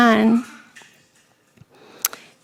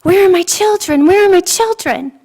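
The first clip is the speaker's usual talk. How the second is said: high, louder cry of alarm, searching; panicked mother; 9 semitones higher, 5 dB louder than usual. Retold or imitated. Mother in her vision suddenly missing her children, panicked. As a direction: call out in sudden fear for the kids. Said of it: "I was like".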